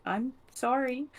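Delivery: high, slow, soft kitty voice; cute apologetic cat. Retold or imitated. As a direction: draw it out sweetly, rising in pitch. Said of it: "acting very cute and was like".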